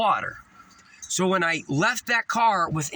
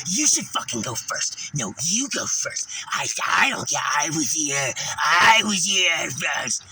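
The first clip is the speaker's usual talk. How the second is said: demons arguing; 3 semitones lower, slower than usual. Retold or imitated. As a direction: act out possessed strangers yelling at each other. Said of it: "watching demons talk to each other".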